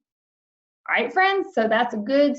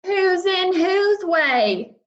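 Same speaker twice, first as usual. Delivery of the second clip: high, slow indignant retort; snapped; 8 semitones higher, much slower than usual. Acted. South-going Zacks snapping at the north-going Zacks, indignant. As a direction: snap it back, offended and slow. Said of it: "snapped the south-going Zacks".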